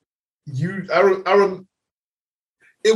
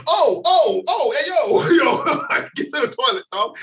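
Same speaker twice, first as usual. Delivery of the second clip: much higher, hurried alarmed calls; mock panic; 8 semitones higher than usual. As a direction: steer him toward the toilet in a laughing panic. Friend about to vomit.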